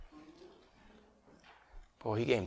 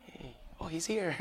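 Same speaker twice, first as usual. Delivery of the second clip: imitating an eager wife; 19 dB quieter than usual. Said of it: "she was by the window".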